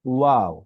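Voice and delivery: Waw said as a lesson demo; letter name ওয়াও for students; Arabic letter name. Shown as a demonstration. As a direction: say ওয়াও clearly with rounded lips. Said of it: "এই হরফের নাম".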